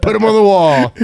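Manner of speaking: loud, high-pitched playful cheer; mock celebratory shout. Friend cheering on the hunter's small trophy, mock celebratory shout. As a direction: yell it high, joining in his celebration.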